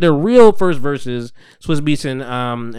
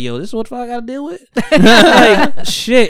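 high, fast, laughing disbelief; 8 semitones higher, 4 dB louder than usual. Retold or imitated. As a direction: crack up while quoting him. Jay-Z reaction, laughing.

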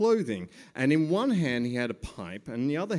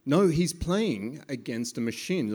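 ordinary talk, nothing marked

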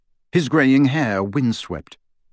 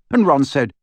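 usual speaking voice

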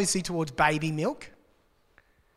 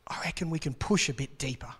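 whisper; 3 semitones lower, 7 dB quieter than usual. Acted. Confiding the writer's challenge.

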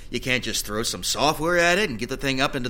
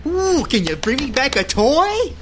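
high mock kid voice; mock him like a little kid; childish voice; 11 semitones higher, 5 dB louder than usual. Mocking coworker in childish voice.

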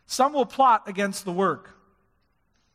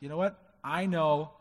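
quiet confidence; 4 semitones lower, 6 dB quieter than usual. Retold or imitated. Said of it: "Nehemiah said".

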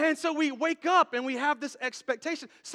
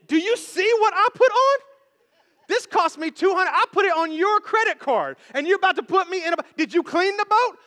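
loud, rising mock outrage; indignant wife; 5 semitones higher, 7 dB louder than usual. Wife to husband at the swamp boat, hammed-up indignation. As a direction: challenge the partner, louder and higher as it builds. Acts annoyed wife on real date.

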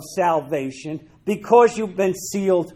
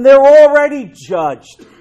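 forceful proclamation; 6 semitones higher, 9 dB louder, slower than usual. Acted. Preaching point.

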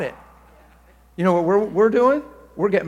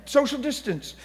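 mock alarm; 10 semitones higher, 9 dB louder than usual. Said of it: "He's like".

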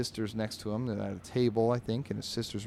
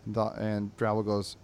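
ordinary talk

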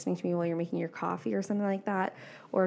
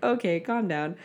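mock inner critic reining someone in; ego-driven inner voice. Ego voice reining in a big dream, mildly dismissive. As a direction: lightly act the dismissive voice in your head. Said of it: "that little ego-driven voice that is like".

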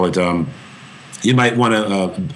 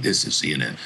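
lower, softer booming announcer voice; James Earl Jones impression; 7 semitones lower, 6 dB quieter, faster than usual. Young actor imitating the CNN tag line, low and soft. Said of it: "I was imitate".

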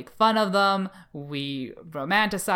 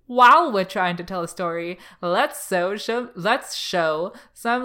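mock showmaker voice; 3 semitones higher, 5 dB louder than usual. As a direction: put on a leering pitch for cheap fanservice. Mocking shows' fanservice in acted quote.

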